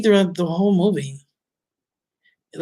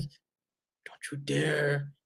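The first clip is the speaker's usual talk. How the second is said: very slow, much softer low growled threat; Batman growl; 3 semitones lower, 13 dB quieter, much slower than usual. Retold or imitated. Mocking Ben's Batman, slow hushed growl. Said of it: "he even does that".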